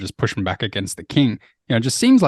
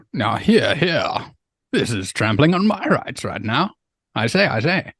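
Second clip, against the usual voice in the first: hammed-up posh English lord, a bit higher; mock English accent; 4 semitones higher than usual. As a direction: play a lightly voiced pompous English noble. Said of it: "try to even do my English accent".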